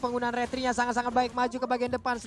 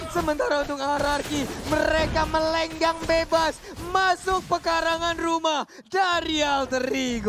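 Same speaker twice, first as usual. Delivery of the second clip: excited caster; 5 semitones higher than usual. Real, not acted. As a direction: stretch the words with hype. Caster describes a push.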